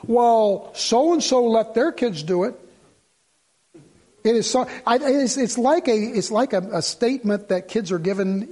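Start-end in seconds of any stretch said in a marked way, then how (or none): 0.1-2.6 drawn-out mock protest; lightly hammed-up child's complaint; imitated complaining child. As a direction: stretch it out like a kid arguing with a parent. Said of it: "Any parent ever said".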